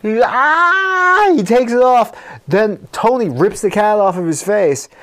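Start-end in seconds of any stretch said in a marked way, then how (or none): 0.2-1.3 much higher, louder yelp; boy yelps as the cat scratches him, much higher; startled yell. Retold or imitated. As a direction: yelp as a cat claws your face. Cat scratches Billy's face.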